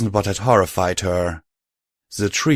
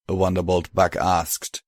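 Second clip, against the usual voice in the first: plain reading voice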